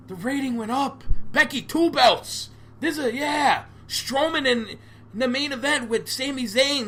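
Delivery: high-pitched mock fanboy gushing; excited WWE fanboy. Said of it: "be a W W E fanboy and go".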